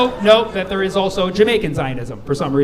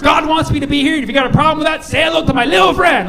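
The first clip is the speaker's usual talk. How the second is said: higher, louder tough-guy threat; menacing zealot; 7 semitones higher, 3 dB louder, faster than usual. Retold or imitated. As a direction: threaten like a gangster. Zealot quote, Scarface line.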